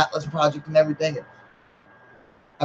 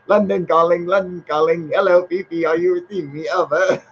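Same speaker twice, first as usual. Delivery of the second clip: higher, mock London radio operator; radio call; 6 semitones higher than usual. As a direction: put on a playful wireless-operator call. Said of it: "Golf Man said".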